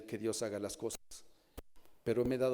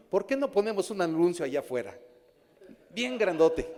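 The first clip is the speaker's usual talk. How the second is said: higher and louder, eagerly pitching an idea; eager young man; 6 semitones higher, 7 dB louder than usual. Retold or imitated. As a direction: sell the big sign idea with youthful excitement. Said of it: "un joven me decía". (joven entusiasmado, más agudo y fuerte)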